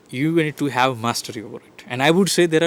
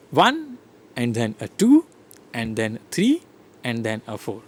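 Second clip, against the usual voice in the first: One and two stretched and rising; rhythmic counting; 3 semitones lower than usual. Shown as a demonstration. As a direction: beat out the numbers, link words kept light. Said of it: "the numbers are stressed and the unstressed".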